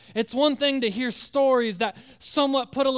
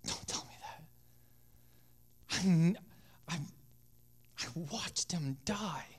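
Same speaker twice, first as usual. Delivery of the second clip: broken, much softer whisper, slowed; desperate whisper; 3 semitones lower, 9 dB quieter than usual. Acted. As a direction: whisper it through grief. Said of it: "He was like".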